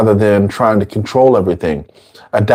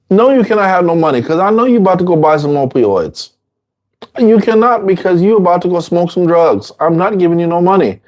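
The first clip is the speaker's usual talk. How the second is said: high, fast mock scolding; scolding refusal; 6 semitones higher than usual. Teacher voicing a friend scolding an addict asking for money, high and fast. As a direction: rattle off the refusal, pitched up.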